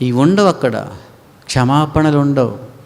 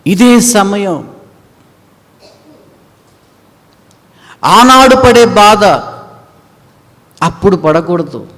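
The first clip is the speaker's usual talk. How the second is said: louder, higher, slow proclamation; slow preaching emphasis; 8 semitones higher, 7 dB louder, slower than usual. Acted. Preacher urging now is the time, slow loud preaching. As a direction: stretch each word.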